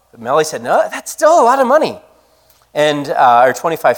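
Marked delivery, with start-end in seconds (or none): 0.6-2.0 higher voiced woman turning down a price; a lightly voiced friend refusing firmly; Melly's refusal. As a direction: lift the voice to play Melly saying no.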